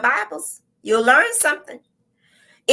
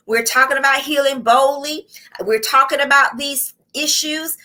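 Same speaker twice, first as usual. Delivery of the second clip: ordinary talk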